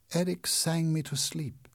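usual speaking voice

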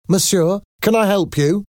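very high-pitched landlady voice. A narrator's reading. Landlady greeting a visitor, high-pitched. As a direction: offer help in a high, lifted voice.

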